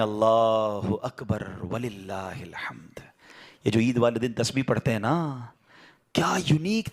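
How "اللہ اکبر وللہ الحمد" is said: slow, hushed whisper of praise; whispered takbir. Acted. Ishmael lying bound whispers the takbir, hushed and slow. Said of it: "وہ کہتے ہیں".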